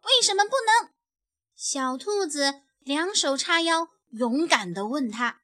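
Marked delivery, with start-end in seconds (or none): 0.0-0.9 high, loud, hands-on-hips defiance; defiant challenge. A narrator's reading. Little bunny, hands on hips, boldly challenging the bully. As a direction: stand up to the bully.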